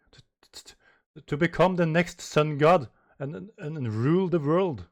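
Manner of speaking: repeated stammer then loud; stuttering. Acted. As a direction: stumble on to then push it out. Stuttering character line.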